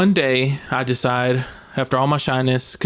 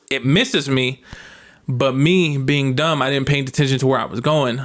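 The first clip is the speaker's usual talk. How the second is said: ordinary talk, nothing marked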